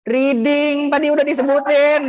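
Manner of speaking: high-pitched teasing protest; mock exasperation. Real, not acted. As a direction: exclaim it high, as if it were obvious. Teacher protests question already answered.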